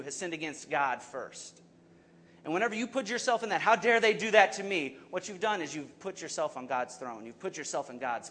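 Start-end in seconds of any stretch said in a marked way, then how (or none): 3.6-5.0 loud, raised indignant quote; acted anger. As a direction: mimic offended outrage with a raised voice. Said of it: "you get angry, you get mad".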